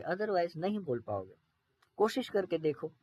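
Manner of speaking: usual speaking voice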